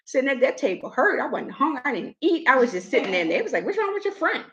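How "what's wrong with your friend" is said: high, softer quoted question; light high-voiced impression; mimicked nosy question. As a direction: mimic the friend asking, pitched up. Retells the others' question.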